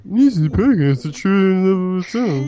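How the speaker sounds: high, loud hammed-up trailer read; overdone trailer voice. Shown as a demonstration. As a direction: ham up the trailer line. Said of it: "I would have said it like that".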